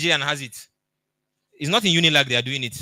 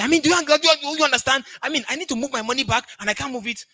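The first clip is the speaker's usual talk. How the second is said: much higher and louder, frantic complaining; exasperated friend; 10 semitones higher, 4 dB louder than usual. Retold or imitated. Friend on a call venting about his stuck money, frantic and high. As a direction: whine it like a stuck, frustrated friend.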